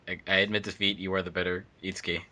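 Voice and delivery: ordinary talk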